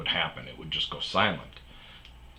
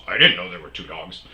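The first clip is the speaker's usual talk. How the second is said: louder put-on voice, caught off guard; mock maintenance man; 9 dB louder than usual. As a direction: blurt it out in surprise. Maintenance man surprised by dogs.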